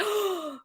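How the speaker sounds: high, theatrical gasp of inspiration; mock excited gasp. Imitating sister's big idea, mock excited gasp.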